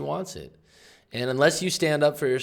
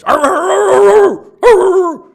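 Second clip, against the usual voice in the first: loud, high imitation of a snapping dog; sudden loud growl-bark, far above the speaking voice; snarling dog; 21 dB louder, slower than usual. Imitating the neighbor's dog as it suddenly snaps, snarling. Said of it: "and the dog goes".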